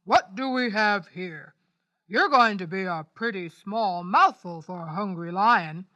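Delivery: loom over the little mouse; big lion voice. A narrator's reading.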